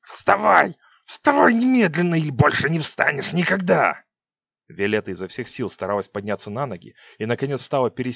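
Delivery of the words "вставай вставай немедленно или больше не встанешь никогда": furious command. Acted. Witch ordering queen up.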